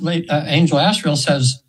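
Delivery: usual speaking voice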